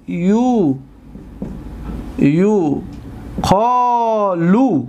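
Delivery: یو ... قالو with pauses, قالو long; slow spelled-out form. Shown as a demonstration. Teacher slowly assembling the passive form for students. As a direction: build یو قالو syllable by syllable. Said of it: "teacher builds the word slowly".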